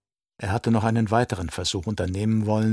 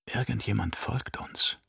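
hushed, softer voice for a thought; soft thinking aloud; inner thought; 8 dB quieter than usual. A narrator's reading. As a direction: say it privately, under the breath. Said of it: "dachte sie".

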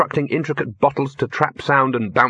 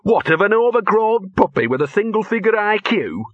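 high, scornful sneer; 10 semitones higher than usual. Acted. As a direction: sneer at the rival dog. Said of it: "sneered Gaspode".

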